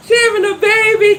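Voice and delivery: bursting with happy news; excited. Real, not acted. Excited news read from live chat.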